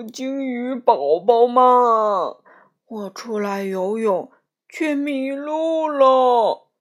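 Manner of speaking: slow, loud tearful whale voice; crying baby whale. Acted. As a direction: wail it like a lost baby whale. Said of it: "鲸鱼哭着说".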